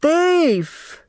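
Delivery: piercing high shriek; high shrieked accusation. A narrator's reading. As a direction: shriek it like you've caught a thief. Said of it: "the secretary shrieked".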